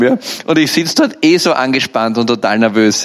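ordinary delivery

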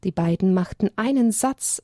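usual speaking voice

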